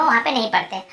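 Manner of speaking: ordinary delivery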